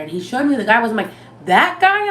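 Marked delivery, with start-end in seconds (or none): 1.5-2.1 high, loud disbelieving question; retold astonished double-take; incredulous. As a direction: shoot the voice up in disbelief. Retold disbelief at accused guy.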